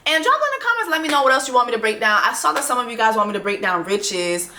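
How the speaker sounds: ordinary delivery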